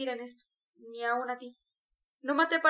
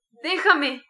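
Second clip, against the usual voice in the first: very loud anguished shout; heart-rending outcry; 2 semitones higher, 10 dB louder than usual. A narrator's reading. Raskolnikov telling Sonia to leave him, anguished shout. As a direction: burst out in torment. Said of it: "con acento desgarrador".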